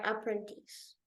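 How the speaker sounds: plain reading voice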